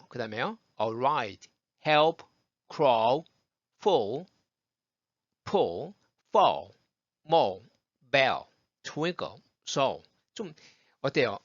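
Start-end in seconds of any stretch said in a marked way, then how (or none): 0.8-10.0 each L darkened toward "우", as in "bell"; dark L drill. Shown as a demonstration. Teacher has learners feel the L sound, dark "우"-like endings. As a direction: say each word separately, L like a dark "우". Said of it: "어두운 우처럼 발음이 되는".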